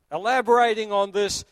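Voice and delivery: normal talking voice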